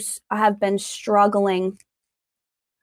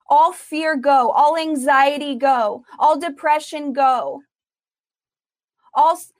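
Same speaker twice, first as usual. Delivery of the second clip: forceful deliverance prayer, punched words; shouting faith healer; 5 semitones higher than usual. Acted. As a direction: command the spirits to go. Rebuking spirits, deliverance prayer.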